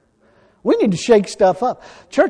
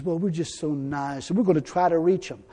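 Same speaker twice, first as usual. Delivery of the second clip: mock meek voice; 6 semitones lower, 10 dB quieter than usual. Mocking soft nice Christians.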